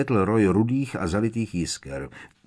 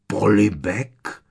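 loud, drawn-out POLIBEK, rising as a question; stunned, slow "Polibek?" with rising end; incredulous echo; 2 semitones higher, 6 dB louder, much slower than usual. A narrator's reading. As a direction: stretch "Polibek" in loud disbelief. Opakoval strýc Vernon.

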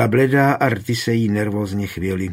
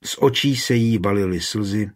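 ordinary delivery

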